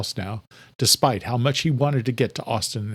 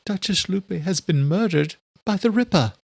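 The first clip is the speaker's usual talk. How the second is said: higher grave servant; Adela's voice; 8 semitones higher than usual. A narrator's reading. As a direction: report the murder, higher. Adela reports murder.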